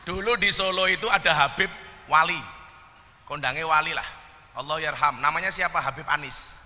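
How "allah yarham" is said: ordinary talk, nothing marked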